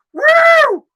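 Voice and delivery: very high, loud "woo!"; construction worker's catcall whoop. Acted. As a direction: whoop at a passerby like a union guy. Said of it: "working in Boston to be like".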